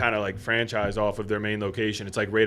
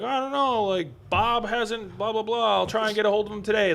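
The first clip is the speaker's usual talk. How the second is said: much higher, breezy voice for the vague secretary; mock secretary voice; 10 semitones higher than usual. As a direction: play the secretary brushing him off, pitched way up. Secretary's excuse voiced in story.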